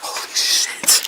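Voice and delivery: slow stunned whisper; shocked whisper. Real, not acted. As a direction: breathe it out slowly, shaken. Startled by noise in dark.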